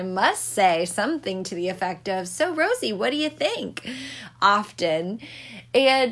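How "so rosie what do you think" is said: high, dramatic sassy question; mock-dramatic own catchphrase, pitched up; sassy mom quote. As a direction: toss it off with hand on hip. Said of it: "twirl around dramatically with my hands on my hips".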